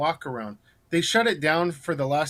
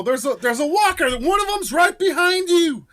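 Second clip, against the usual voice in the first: high, pressing warning to the couple; urgent warning; 12 semitones higher, 4 dB louder than usual. Retold or imitated. Vigilante warning couple of walker, urgent and high. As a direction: hype up the danger. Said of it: "Like, you know".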